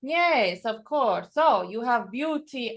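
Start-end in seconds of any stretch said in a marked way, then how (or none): none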